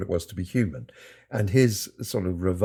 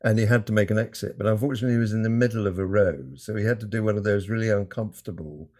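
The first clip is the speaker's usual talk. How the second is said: ordinary talk, nothing marked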